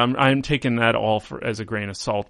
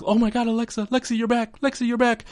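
much higher, gushing relief; overjoyed Nikki; 11 semitones higher than usual. Retold or imitated. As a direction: squeal it like a friend who got her back. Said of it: "Nikki's sitting there like".

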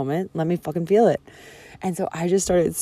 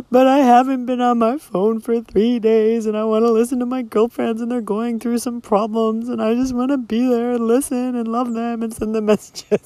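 whiny protest; 7 semitones higher than usual. Retold or imitated. Whiny protest retold to boyfriend.

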